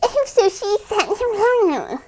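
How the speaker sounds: high, drawn-out mock parent voice; imitating a parent. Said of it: "whenever I imitate, like, one of my parents".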